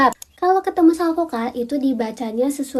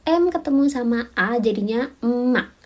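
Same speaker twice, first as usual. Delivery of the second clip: normal talking voice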